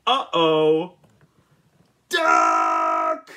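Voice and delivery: high, loud shouted name; angry farmer yelling Duck; furious scream. A narrator's reading. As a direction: scream the duck's name in anger. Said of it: "screamed Farmer Brown".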